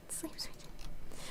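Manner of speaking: slow, breathy goodnight whisper. Pretend. Whispering goodnight to a sleepy friend, slow and soft. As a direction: whisper it like tucking someone in.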